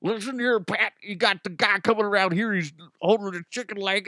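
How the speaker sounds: high, exaggerated sportscaster impression; John Madden. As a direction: bluster on as if mouth full. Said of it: "attempted to do a John Madden impression".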